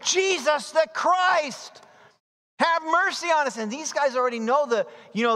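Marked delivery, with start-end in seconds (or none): 0.0-3.6 calling out. Acted. Blind men calling out.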